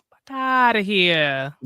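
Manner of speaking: a gruff, slow New York brush-off; New York accent, dismissive. Retold or imitated. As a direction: say it like a New Yorker waving you off. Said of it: "in my head I had a New York acc-, uh, accent".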